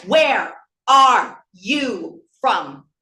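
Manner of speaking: loud, slow "Where. Are. You. From."; loud, halting challenge on every word; angry word-by-word emphasis. Acted. Bitter climax quoting the question.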